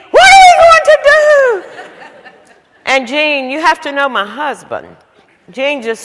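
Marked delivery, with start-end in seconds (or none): 0.1-1.6 mock panic. Asks husband in panic.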